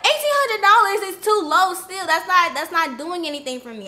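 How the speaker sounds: loud indignant rant. Retold or imitated. To the manager about eighteen hundred dollars, outraged and loud. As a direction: shout down the lowball offer. Rejecting the offer.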